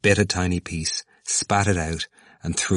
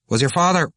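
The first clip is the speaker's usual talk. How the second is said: high, louder question, cut off after "father"; broken-off question; 11 semitones higher, 5 dB louder than usual. A narrator's reading. Cranley starts asking about the father, a broken-off question. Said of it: "Cranley interrupted himself for an instant".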